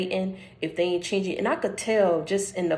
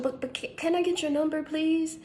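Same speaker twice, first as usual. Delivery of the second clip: put-on much higher, hesitant girlish ask; imitating her shy ask; 9 semitones higher, 3 dB quieter than usual. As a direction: play her timidly asking for the number. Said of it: "she said".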